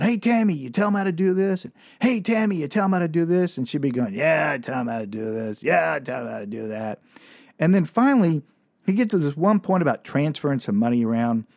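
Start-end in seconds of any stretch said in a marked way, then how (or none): 0.0-1.6 high-pitched nagging call to a coworker; boss yelling out. Retold or imitated. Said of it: "that weirdo boss would yell out". 2.0-3.6 high-pitched nagging call to a coworker; boss yelling out. Retold or imitated. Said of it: "that weirdo boss would yell out". 4.1-7.0 put-on drunken slur. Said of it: "drunken, slurred way".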